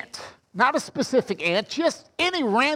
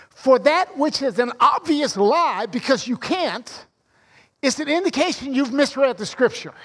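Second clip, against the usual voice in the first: ordinary talk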